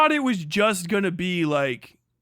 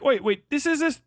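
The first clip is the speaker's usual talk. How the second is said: startled realization; 9 semitones higher than usual. Retold or imitated. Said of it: "I was like".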